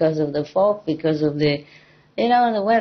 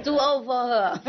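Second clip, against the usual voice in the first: laughing tease; 6 semitones higher, 5 dB quieter than usual. Pretend. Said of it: "I'm just kidding".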